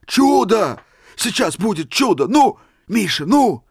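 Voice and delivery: can barely wait; excited anticipation. A narrator's reading. Old man urging Misha toward the miracle, excited.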